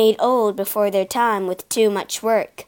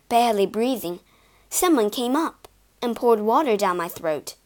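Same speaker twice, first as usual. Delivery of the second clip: ordinary delivery, nothing marked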